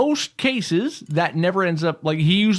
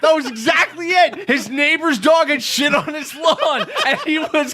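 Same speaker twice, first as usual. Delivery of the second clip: words breaking into laughter, pitched up; laughing while talking; 10 semitones higher, slower than usual. Real, not acted. Salesman laughing as he retells the Italian customer, laughing speech. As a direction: try to talk through the laughter.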